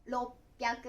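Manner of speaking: 六百 read "rop-pyaku", stopped before the p; roppyaku. Shown as a demonstration. Teacher counting hundreds for learners, roppyaku with a held stop. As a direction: cut 六 to "rop", then "pyaku". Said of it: "ろと読みます".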